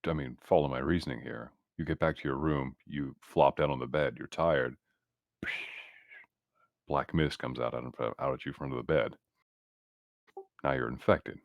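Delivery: ordinary talk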